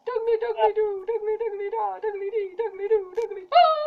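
high, laughing shrieks of the name; laughing panic. Real, not acted. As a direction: shriek through giggles as he chases you. Screaming at Douglas while laughing.

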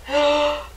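high audible gasp; dramatic gasp. A narrator's reading. Storyteller spots a hornet's nest, theatrical gasp. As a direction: gasp as if startled by a discovery.

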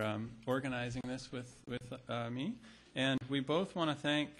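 usual speaking voice

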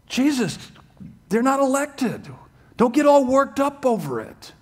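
preacher mocks a Calvinist brushing off weeping Jesus. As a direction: talk down to Jesus in a snide mock tone. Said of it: "According to Calvinism".

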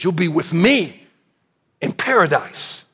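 slow, with me leaned on hard; stress on me. Shown as a demonstration. Preacher reading Jesus's promise, stress on me. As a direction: land on the word me, slowly. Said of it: "With me is actually emphasis".

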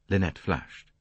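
ordinary delivery

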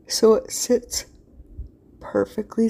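words stretched through a real yawn. Real, not acted. Crafter explaining the sign stand to viewers, yawning mid-sentence. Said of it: "Sorry, I'm yawning".

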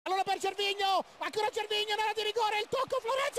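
fast excited play-by-play building; breathless italian goal commentary. Real, not acted. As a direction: build the tension of the attack.

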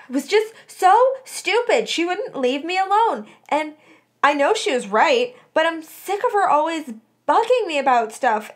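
high, loud venting rant; fuming. A narrator's reading. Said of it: "Melody fumed to Sirius".

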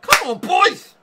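very high, very loud roar; rallying yell. Real, not acted. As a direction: scream at the players to win it. Rallying team after goal.

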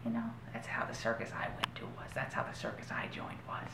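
fast, breathless whisper about the circus. Acted. A performer whispering to Todd, fast and hushed. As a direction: hush it out quickly like a secret.

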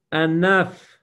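a loud, sharp "Enough." pitched high; firm command. Shown as a demonstration. Preacher shows the congregation how to rebuke their soul, commanding loudly. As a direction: order your own soul to stop. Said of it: "communicate to your soul".

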